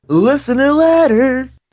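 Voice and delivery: high booming ghoul announcer; slow, loud, spooky showman call; horror host. Acted. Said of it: "give him one more".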